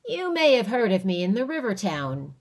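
loud, boastful frontier man; Ben. Acted. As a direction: brag a little.

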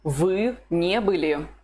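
Вы не были said slowly for the learner to copy; slow model answer. Shown as a demonstration. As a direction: leave space between the words. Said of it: "What's the Russian for".

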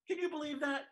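normal talking voice

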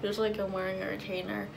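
thin film over teeth; slightly muffled teeth sounds; whitening strips on teeth. Real, not acted. Seen 0: Applied at -2.0; teeth covered, pressed on at 6.8; peeled off at 28.7.

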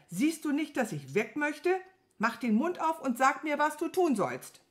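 impatient. A narrator's reading. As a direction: press the dawdler for an answer. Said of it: "wurde er ungeduldig".

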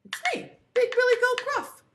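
high, loud goat voice; big goat. Acted. Big goat boldly answering the troll, loud. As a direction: announce yourself high and loud.

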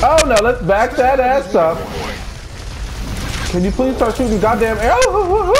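high-pitched, agitated complaint; exasperated outburst. Real, not acted. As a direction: plead in exasperation, voice pushed up. Player exasperated in a fight.

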